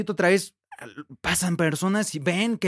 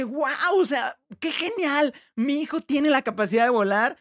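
amazed father; 5 semitones higher than usual. Retold or imitated. Father seeing his son fly, delighted and amazed. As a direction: let the dad gush in delight at his son. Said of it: "el papá dice".

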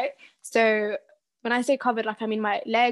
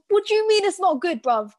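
indignant retort; 8 semitones higher, 5 dB louder than usual. Retold or imitated. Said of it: "I'm like".

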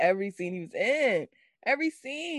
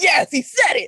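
loud, higher-pitched triumph; excited cheer; 7 semitones higher, 6 dB louder than usual. Retold or imitated. Fan hearing the awaited catchphrase, excited cheer. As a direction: celebrate like a thrilled fan.

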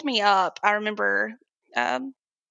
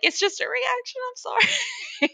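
squeaky apology. Retold or imitated. Patient apologizing to the doctors for moving, high squeak. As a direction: shrink into a tiny high sorry.

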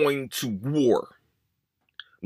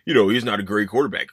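dismissive mock voice, dropped much lower and muttered; mocking doubters; 9 semitones lower than usual. Host mocking fans who dismiss the quarterback, in a low dismissive mock voice. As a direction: sneer it like the critics who shrug him off. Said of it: "and I know people".

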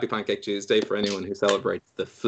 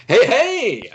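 higher, louder cheery greeting; show opener; 12 semitones higher, 7 dB louder than usual. Real, not acted. Host welcomes listeners to the show, high loud greeting. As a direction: greet the audience with a bright burst.

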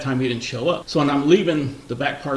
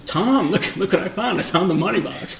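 higher, eager call to the partner; excited rookie; 6 semitones higher, 4 dB louder than usual. Retold or imitated. Rookie officer calls his partner, excitedly. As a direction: show the find off with rookie glee. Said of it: "I'm all excited".

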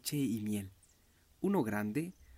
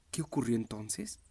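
usual speaking voice

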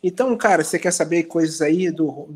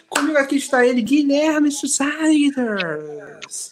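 announcer intro; 6 semitones higher, much slower than usual. Pretend. Announcing guest dramatically.

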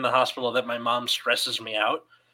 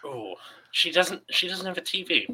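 gossipy mom; 9 dB quieter than usual. Retold or imitated. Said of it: "she'll be like".

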